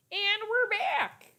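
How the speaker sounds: high, drawn-out singsong announcement; mock-announcer return. Real, not acted. Streamer back with the lost chat, high playful announcement. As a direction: sing out the comeback, slow and high.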